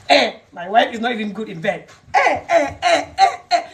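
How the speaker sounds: high, laughing put-on husband voice; mock whiny husband. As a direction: whine like a sulky husband making excuses. Mocking a husband's excuses.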